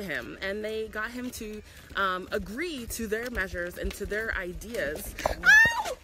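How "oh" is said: sudden loud high cry; real shocked high yelp; startled yelp of pain. Real, not acted. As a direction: yelp as if just bitten. Dog bites her during livestream.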